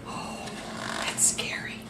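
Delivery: hushed whispered fright. Real, not acted. Storyteller reacting to the shark's roar, whispered. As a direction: whisper it as if scared. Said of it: "reacts to shark".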